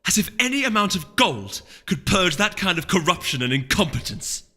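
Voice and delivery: loud, high contemptuous scoff. Acted. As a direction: sneer at the rival's greed. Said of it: "she scoffed".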